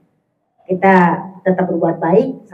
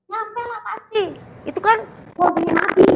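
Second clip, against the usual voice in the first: high, put-on voice of the arguing friend; friend's voice; 12 semitones higher than usual. Friend insisting to the preacher that prayers reach, high mock voice. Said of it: "kata dia nih".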